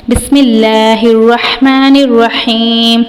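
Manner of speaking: slow, drawn-out tilawat of بسم اللہ الرحمن الرحیم; Quranic recitation. Shown as a demonstration. Teacher opens with Quranic recitation. (آہستہ تلاوت کے انداز میں)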